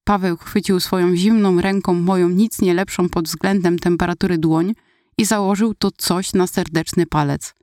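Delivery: usual speaking voice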